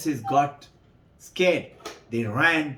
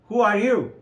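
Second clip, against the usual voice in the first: sharp, raised demand from the god Brahma; indignant challenge; 7 semitones higher, 4 dB louder than usual. Acted. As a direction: ask it like an offended god.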